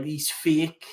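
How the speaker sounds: normal talking voice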